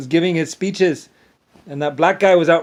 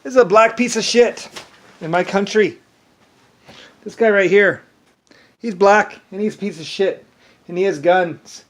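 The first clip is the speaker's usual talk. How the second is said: louder and higher, pointing at a photo; heated retold rant, raised voice; 5 semitones higher, 4 dB louder than usual. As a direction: bark it out like an angry speech. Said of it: "I said".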